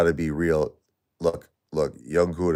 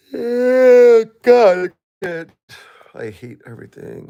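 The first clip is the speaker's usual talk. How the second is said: much higher, loud anguished cry that drops away; 11 dB louder, slower than usual. Real, not acted. Grieving widower on stream crying out in real anguish. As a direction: cry out in grief, then break off.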